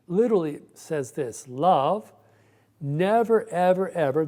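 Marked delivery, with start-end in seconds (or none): 1.5-4.3 never punched high and loud, ever ever pressed hard after it; emphatic stress. Shown as a demonstration. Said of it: "there's an emphatic".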